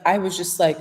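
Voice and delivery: ordinary talk, nothing marked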